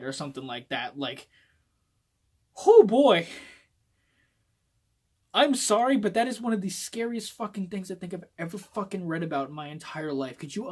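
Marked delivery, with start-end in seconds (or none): 2.7-6.7 loud dread. Real, not acted. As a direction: blurt it out, genuinely spooked. Reacting to a scary spoiler.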